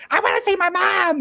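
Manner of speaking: put-on high, loud childish wail for mom; whiny kid yell. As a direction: yell it like a twelve-year-old who wants his mom. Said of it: "He's just like".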